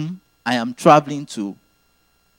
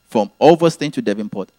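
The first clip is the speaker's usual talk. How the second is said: usual speaking voice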